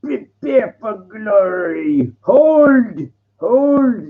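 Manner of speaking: slow, high battle cry; rally the troops like a Spartan king; drawn-out high battle cry; movie quote, 300. Retold or imitated. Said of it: "the three hundred".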